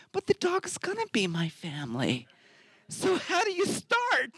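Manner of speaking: rising, slowing into a high squeak; mock bewildered. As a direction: play the clueless single guy. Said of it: "I was thinking".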